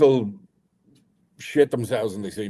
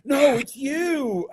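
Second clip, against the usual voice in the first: imitating insistent fan; 13 semitones higher, much slower than usual. Fan insisting to Big D, slow high shout. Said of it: "And I'm like".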